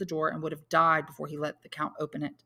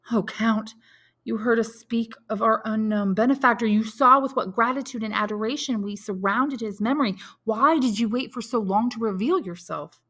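raised, fervent outpouring; louder and higher, pour out gratitude and wonder; emotional appeal; 5 semitones higher, 5 dB louder than usual. A narrator's reading. Grateful man pleads with his benefactor.

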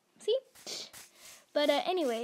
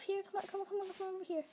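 higher, softer coaxing call to the dog; 6 semitones higher, 6 dB quieter, much faster than usual. Pretend. Coaxing her game dog to climb over, soft and high. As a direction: sweet-talk the dog over.

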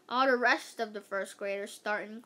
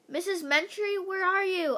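high, louder searching call; 8 semitones higher, 5 dB louder than usual. A narrator's reading. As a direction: call out for someone missing. Calling for missing Missus Mentry.

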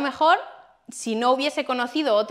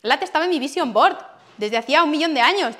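ordinary delivery

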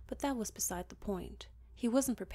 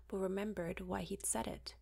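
usual speaking voice

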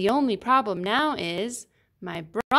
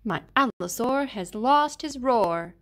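ordinary delivery, nothing marked

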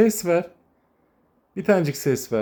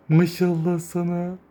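higher, slow admiring coo; fond coo; 6 semitones higher, slower than usual. Real, not acted. Admiring a kitten, slow tender coo.

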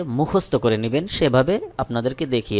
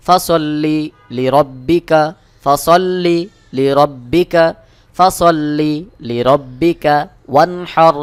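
slow melodic tajweed chant; ধীর সুরেলা তিলাওয়াত; Quran recitation; 6 dB louder, much slower than usual. Shown as a demonstration. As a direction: draw out every vowel in recitation. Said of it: "পরের আয়াত পড়বেন".

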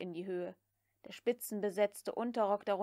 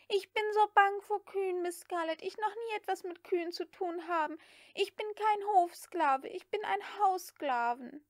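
high, pleading maid's voice; whining maid Prissy; 9 semitones higher, 3 dB louder than usual. A narrator's reading. Prissy pleads with Scarlett, high and whiny. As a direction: plead your way out of it.

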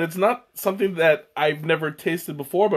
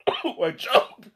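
strained, higher voice after choking; 7 semitones higher than usual. Real, not acted. Streamer chokes mid-greeting to viewers, choking. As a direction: squeeze the words out between coughs. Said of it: "I kinda choked there".

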